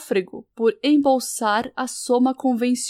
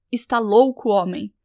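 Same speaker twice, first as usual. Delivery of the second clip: ordinary delivery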